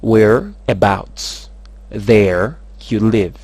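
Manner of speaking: very slow, word by word; slow breakdown. Shown as a demonstration. Teacher breaks the question down for learners, very slow. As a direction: break it into drawn-out pieces.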